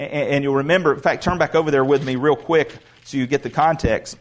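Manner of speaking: ordinary delivery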